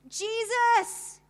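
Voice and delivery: desperate full-voiced scream, high and loud; screaming out Jesus. Trapped woman in the jungle screams for Jesus, full-force scream. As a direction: cry out the name with everything in you. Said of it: "within me was screaming out the name".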